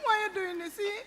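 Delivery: soft, tearful questioning; tearful plea. Acted. Woman asks her tormentor why, tearful plea. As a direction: plead softly through tears.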